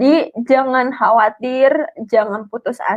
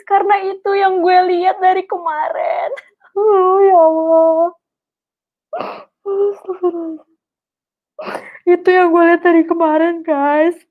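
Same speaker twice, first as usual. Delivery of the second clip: real crying, pitch pushed higher; 6 semitones higher than usual. Real, not acted. Speaker telling viewers about the longed-for car, crying. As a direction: sob through the words, overwhelmed by the gift.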